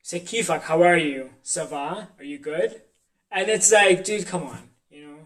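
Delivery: ordinary talk, nothing marked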